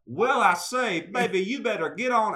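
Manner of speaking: high, loud Southern caricature; mock Southern accent. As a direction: imitate a girlfriend's exaggerated take on a Southern accent. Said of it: "my girlfriend does my accent".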